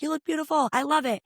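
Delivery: high, gushing compliment; community member gushing over her outfit, high; gushing praise. Retold or imitated. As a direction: cheer her on. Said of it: "be like".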